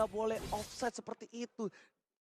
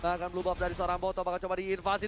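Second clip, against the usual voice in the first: ordinary delivery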